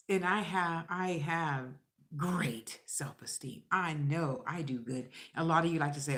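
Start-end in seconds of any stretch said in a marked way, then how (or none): 2.2-3.6 slow breathy whisper of great self-esteem; hushed, slow, smug whisper. Real, not acted. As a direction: drop to a whisper, slowly. Whispered aside.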